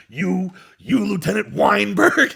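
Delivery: hammed-up Nicholson delivery; Nicholson impression. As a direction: lean on the name, slow and menacing. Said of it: "he does the take for the first time".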